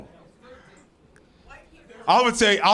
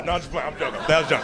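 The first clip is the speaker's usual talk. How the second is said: normal talking voice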